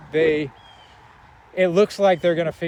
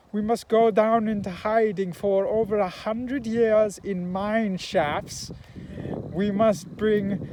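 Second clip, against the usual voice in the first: put-on higher, slow, heavy German accent; pompous German-accented scientist, slow and higher; 6 semitones higher, slower than usual. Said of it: "And he talks like this".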